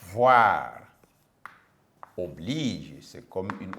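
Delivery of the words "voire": ordinary talk, nothing marked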